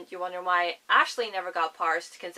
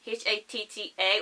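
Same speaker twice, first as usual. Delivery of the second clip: ordinary talk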